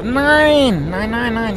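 mock-emphatic denial. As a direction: wave the rumour off with a stretched no. Comic denial correcting his own legend.

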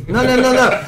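normal talking voice

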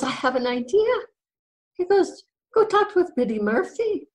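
high, excited acted suggestion; bright idea. Husband sending his wife to the neighbour, excited. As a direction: pipe up as the idea strikes.

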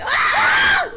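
high startled yell; scared yelp. Real, not acted. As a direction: yelp in fright. Sudden scare.

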